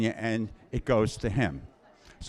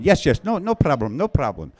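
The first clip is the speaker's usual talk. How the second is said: quick, high, eager yes-yes; mock the owner's keen reassurance; a touch of a keen motel owner; 3 semitones higher than usual. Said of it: "owner eagerly agreeing".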